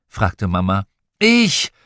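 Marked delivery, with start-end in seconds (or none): 1.2-1.7 put-on high, loud child's cry; bright-eyed, high-pitched yell; eager shout. A narrator's reading. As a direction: call out like keen children volunteering. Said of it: "riefen wir alle".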